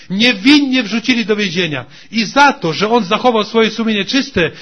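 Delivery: loud, rising sermon climax; preaching at full force. Real, not acted. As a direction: drive it home to the congregation.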